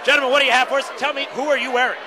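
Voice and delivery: bright, eager red carpet host; gushing red carpet chatter. Acted. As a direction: gush like an awards-show host. Red carpet host pre-show.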